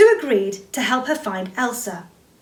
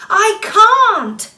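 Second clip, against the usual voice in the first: high, loud despairing shout; frustrated outcry; anguished cry; 10 semitones higher, 8 dB louder than usual. Acted. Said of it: "she cried out".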